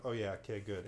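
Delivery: usual speaking voice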